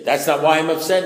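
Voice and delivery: loud, affronted comeback; indignant retort. Acted. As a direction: snap back in protest at your sponsor. Voicing an indignant comeback to sponsor.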